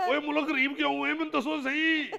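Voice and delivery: high-pitched, incredulous challenge; exasperated questioning. Acted. Officer asking why the country is poor, exasperated.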